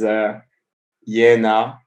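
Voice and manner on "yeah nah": put-on Australian accent. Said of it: "all I know about Australia is".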